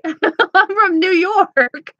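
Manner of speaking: slow, high laugh through the words. Real, not acted. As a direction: giggle it out, amused at yourself. Laughing at her own twang.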